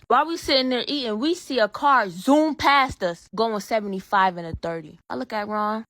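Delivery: ordinary talk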